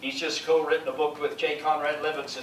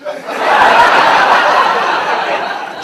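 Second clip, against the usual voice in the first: big laugh; 15 dB louder than usual. Real, not acted. Laughs at hair joke.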